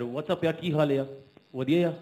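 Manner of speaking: put-on UK Punjabi accent. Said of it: "they talk like that".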